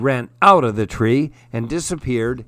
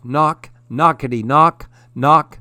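usual speaking voice

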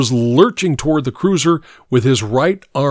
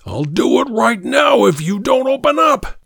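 high frantic shouted threat; frantic shouting hostage taker; 11 semitones higher than usual. Acted. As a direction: yell it desperate and wild. Gunman threatening at car window.

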